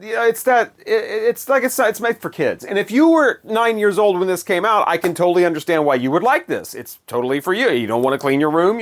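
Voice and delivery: ordinary talk, nothing marked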